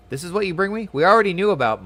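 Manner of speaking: lightly voiced impatient leader; scornful commander. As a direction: snap back at the useless spy.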